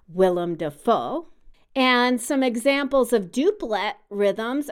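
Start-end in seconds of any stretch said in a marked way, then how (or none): 0.1-1.3 "Willem Dafoe" with the weight landing on FOE; stress on FOE. Shown as a demonstration. Host demos the name with stress on the last syllable. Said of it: "his last name is emphasized on the last syllable".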